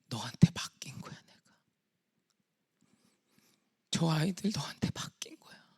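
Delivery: half-whispered solemn reassurance; God's voice. Retold or imitated. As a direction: confide gently as God, often breaking into whisper. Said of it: "하나님이 저한테 이랬어요".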